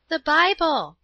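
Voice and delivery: high, loud surprised cry. A narrator's reading. Paula spotting the teacher's Bible, high loud surprised cry. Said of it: "cried Paula".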